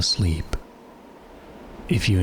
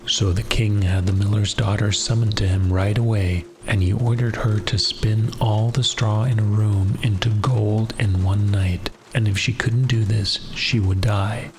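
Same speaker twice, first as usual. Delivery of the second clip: bedtime story narration. Acted. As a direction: lull the listener toward sleep.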